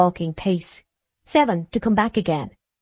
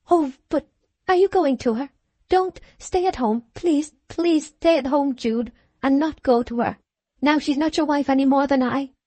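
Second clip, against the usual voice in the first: higher, urgent pleading; pleading Sue; 6 semitones higher than usual. A narrator's reading. Sue begging Jude to stay at home, high and pleading. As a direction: beg him to stay home.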